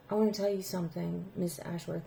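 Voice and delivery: soft and fast, barely above a hush; nervous student working up to it; timid hush. Retold or imitated. Student to her teacher, nervous and very soft. Student hesitantly about to confess.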